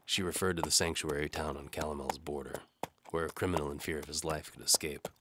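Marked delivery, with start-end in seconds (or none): none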